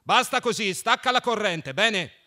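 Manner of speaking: high, loud called-out orders; loud shouted instruction; shouting. A narrator's reading. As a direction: yell it across to someone far off. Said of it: "gridò forte".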